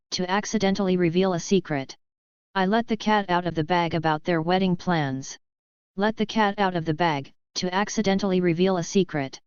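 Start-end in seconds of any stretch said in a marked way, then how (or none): none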